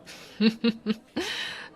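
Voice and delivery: short giggle, rising in pitch; amused little laugh. Acted. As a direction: chuckle at seeing someone dear. Orc woman greeting human she likes.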